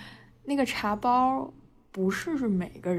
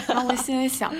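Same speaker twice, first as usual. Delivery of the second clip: ordinary talk, nothing marked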